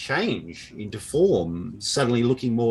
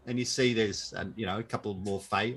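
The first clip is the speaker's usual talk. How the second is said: ordinary talk, nothing marked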